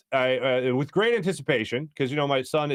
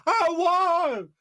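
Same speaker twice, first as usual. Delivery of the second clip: high-pitched triumphant yell, drawn out; Joel Embiid. Retold or imitated. Imitating a player celebrating in practice, high triumphant cry. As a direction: crow the win in a high imitation. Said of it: "you gotta love Joel Embiid in practice".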